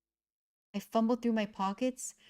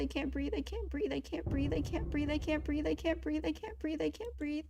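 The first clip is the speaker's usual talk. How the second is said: breathless panicked repetition, higher and fading softer; panic attack; 6 semitones higher, 4 dB quieter than usual. Acted. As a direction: gasp it out faster and fainter as the air runs out.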